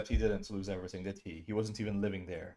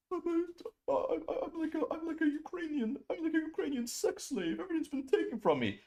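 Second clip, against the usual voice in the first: high, soft put-on whimper; mock whiny victim; 3 dB quieter than usual. Streamer mocking Dylan's self-pity, high whiny voice. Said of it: "He talks about, like".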